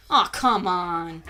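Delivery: ordinary talk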